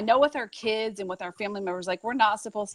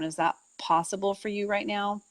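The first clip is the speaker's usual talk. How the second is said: ordinary talk, nothing marked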